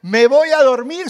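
loud and high, announcing bedtime; husband's booming goodnight; mock announcement. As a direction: call it out big and pleased. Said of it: "y le dice".

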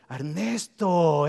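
loud, drawn-out name call; loud slow call to a congregant. Real, not acted. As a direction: call him up from across the room. Calling a man forward by name.

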